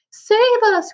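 high-pitched plea for help; narrated shriek, pitch leaping up; panicked cry. A narrator's reading. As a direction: cry out in alarm as the storyteller. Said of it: "cried Grandma Josephine".

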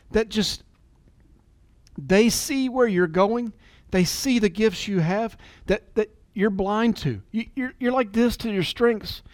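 ordinary talk

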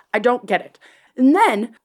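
ordinary talk, nothing marked

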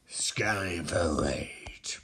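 slow, low whispered growl; rage growl. Acted. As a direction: draw out RAGE in a low rasp. Ring scans for rage.

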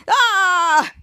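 short loud high yelp of fright; mock startled cry. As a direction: jump and yelp as if caught. Henry startled by neighbor.

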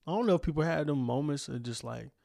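ordinary delivery

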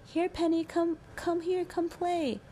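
put-on high, slow little boy calling; imitating a small boy calling his sister. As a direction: call sweetly like a toddler. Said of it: "watching Max go".

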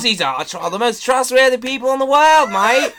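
loud, high hammed-up Australian reassurance; imagined scammer luring him in a mock Australian accent. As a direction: sell the scam in a cheesy Australian accent. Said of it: "Aussies ... mate".

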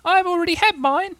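very high little-Roo voice, proud. A narrator's reading. Roo to Kanga after his medicine, proud and very high. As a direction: squeak it out with childlike pride. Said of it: "Roo said proudly".

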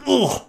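high-pitched mock retch; retching ugh. Imitating little sister at the game table, retching a little. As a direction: gag out a disgusted noise. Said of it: "like, retch a little bit".

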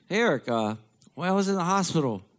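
put-on high, puzzled old dad voice; imitating dad. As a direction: play the dad innocently asking his son.

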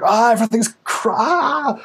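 high-pitched hammed-up freak-out; mock panic. Coach mimicking people caught up in the moment, mock panic. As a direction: wail it like a comic meltdown, voice shooting up. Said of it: "are so of the moment".

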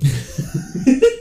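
shrill falsetto burst of laughter; high squealing laugh. Real, not acted. Laughs at chewing sound.

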